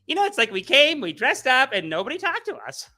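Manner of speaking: put-on whiny girl voice, mildly hurt; disappointed girls. Girls who dressed up complaining nobody talked, high whiny voice. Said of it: "they're actually like".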